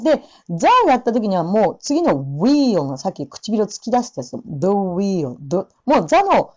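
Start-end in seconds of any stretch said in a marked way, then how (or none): none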